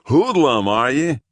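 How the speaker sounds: policeman voice. Acted. Policeman mocking.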